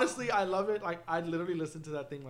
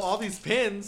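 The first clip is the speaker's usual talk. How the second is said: usual speaking voice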